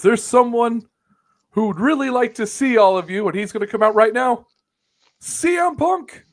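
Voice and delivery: high, loud, over-excited mock introduction; Tony Khan. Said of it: "Tony Khan goes".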